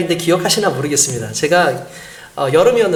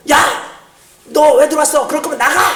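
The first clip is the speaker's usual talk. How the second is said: higher-pitched, acted father's telling-off; angry scolding; 9 semitones higher than usual. Father scolds his child for coming home late, acted angry outburst. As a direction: scold sharply, imitating an angry dad. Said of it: "막 야단을 치니까".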